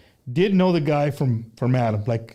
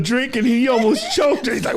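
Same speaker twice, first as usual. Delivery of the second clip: ordinary talk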